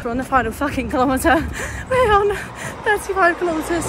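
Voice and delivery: high, loud burst on the last kilometre; elated. Real, not acted. Runner telling viewers of the final kilometre, elated. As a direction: cry out the milestone, pitch jumping up.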